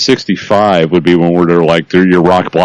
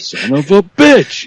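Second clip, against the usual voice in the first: mock curse; 10 semitones higher, slower than usual. Mock annoyed listener.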